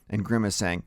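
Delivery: ordinary delivery, nothing marked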